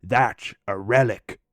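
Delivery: slow, lightly voiced amazed old guy; mock awe at the old sprinkler; imitating Mike. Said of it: "he'd be like".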